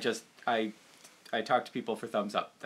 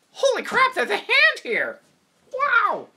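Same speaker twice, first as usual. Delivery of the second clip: high, loud startled puppet yelp; 8 dB louder than usual. Acted. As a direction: jump at the sight of a hand.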